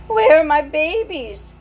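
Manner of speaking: high, loud and slow, worn out; exhausted mother. Acted. Tired mouse mother asking for her babies after birth. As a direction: call weakly for her babies. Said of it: "said the exhausted mother".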